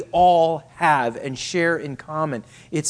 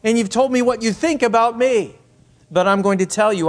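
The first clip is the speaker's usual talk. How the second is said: Peter's bold vow; 6 semitones higher, 5 dB louder than usual. Acted. Preacher voicing Peter's boastful vow.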